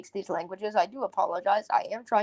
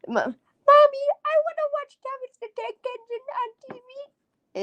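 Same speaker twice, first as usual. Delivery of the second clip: put-on high, whispery little kid pleading; kid begging mommy; 5 dB louder than usual. Child begging mommy for a cartoon. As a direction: beg mommy like a small child.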